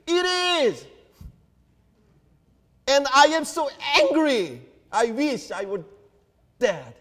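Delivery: high, loud angry outburst; Jonah's temper. Acted. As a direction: burst out in a furious temper. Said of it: "Look at Jonah's temper".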